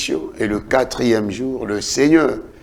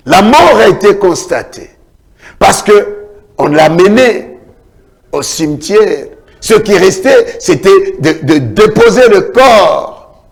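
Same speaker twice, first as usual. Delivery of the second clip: loud, high preacher's cry; fiery preaching; 9 semitones higher, 9 dB louder than usual. Acted. Pastor retelling the funeral at Nain to the church, fiery preaching. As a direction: build the story with full force. Preaching the widow's son story.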